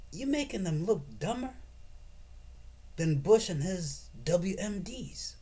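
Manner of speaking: ordinary talk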